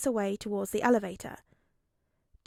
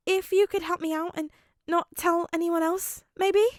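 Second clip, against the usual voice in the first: put-on high, bright nervous boy; eager young boy voice; 8 semitones higher, 5 dB louder than usual. A narrator's reading. Midoriya asks for help.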